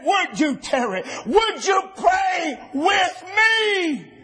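slow, high, stretched-out pleading call; crying plea. Acted. Jesus begging his disciples to pray, slow high crying call. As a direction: stretch out the cry to be heard.

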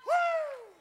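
sharp high whoop; a short piercing cry of joy; high woo. Real, not acted. Preacher's whoop.

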